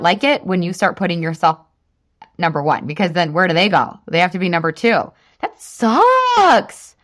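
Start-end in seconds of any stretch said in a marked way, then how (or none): 5.4-6.9 high, loud mock sympathy; a sing-song fake pout over it; mock pity. To her partners, mock pity for losing first place.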